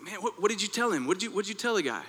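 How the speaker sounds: high, worked-up demand; retold angry outburst, pitched high; furious retelling. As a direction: fire the questions at him in mock fury. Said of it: "I started to get furious".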